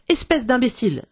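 a touch of spiteful temper; angry insult. A narrator's reading. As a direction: throw the insult out crossly. Fairy snaps an insult.

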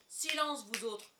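angry shouted command; commanding roar. A narrator's reading. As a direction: roar the crew into silence. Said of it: "rugit Silver".

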